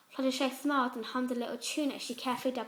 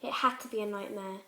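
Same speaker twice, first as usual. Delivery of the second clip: ordinary delivery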